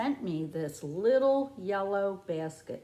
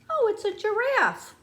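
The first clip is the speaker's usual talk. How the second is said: high, loud gasp of wonder; delighted surprise; 10 semitones higher, 6 dB louder than usual. Pretend. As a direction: gasp and marvel at the giraffe for the kids. Surprise reveal of giraffe.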